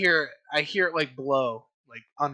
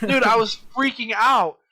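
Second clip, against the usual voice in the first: worked up; 5 semitones higher, 5 dB louder than usual. Real, not acted. Recalls panic over the bug.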